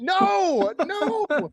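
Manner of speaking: high, loud yell of dismay. Real, not acted. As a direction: cry out, mortified. Flubs word again, yells.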